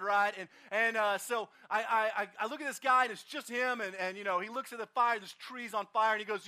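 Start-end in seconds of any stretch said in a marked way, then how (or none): none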